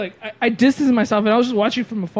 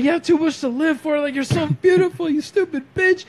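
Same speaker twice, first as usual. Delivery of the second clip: high-pitched frantic mock pep talk; hammed-up exasperated reassurance; retold urgent pleading; 8 semitones higher than usual. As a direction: imitate a friend talking someone down. Imitates friend talking woman down.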